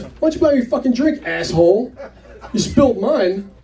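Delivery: high, quick mock anger; irritated demand. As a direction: imitate an angry barfly escalating. Woman repeats demand, now swearing.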